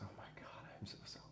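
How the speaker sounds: hushed whisper of guilty realization. Retold or imitated. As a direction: breathe it out as a shocked whisper. Whispered realization at father's bedside.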